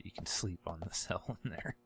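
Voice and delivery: ordinary talk, nothing marked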